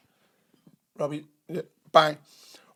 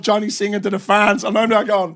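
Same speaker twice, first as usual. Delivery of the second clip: ordinary talk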